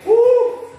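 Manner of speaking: high, loud celebratory whoop; excited whoop. Real, not acted. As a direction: whoop to pump up the class. Coach whoops after set.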